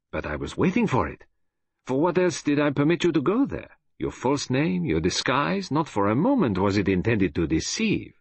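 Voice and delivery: put-on high, lively Belgian-accented explanation; Belgian detective explaining. Detective reveals he foresaw the kidnappers' scheme, high-pitched and animated.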